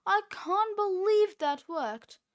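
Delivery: high, loud amazed; excited. A narrator's reading. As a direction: gush with disbelief. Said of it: "cried Cheeseball".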